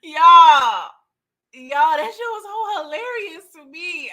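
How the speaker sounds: loud cry of laughter, high; real laughing outburst to viewers; laughing shout. Real, not acted. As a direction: yell it out while cracking up. Host cracking up over scene.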